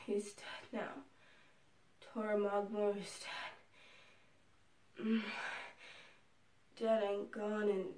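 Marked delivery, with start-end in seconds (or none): 0.0-5.0 slow, soft whispered dread; hushed whisper. Acted. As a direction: whisper it, stunned. Grim whispered realisation.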